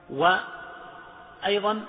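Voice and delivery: usual speaking voice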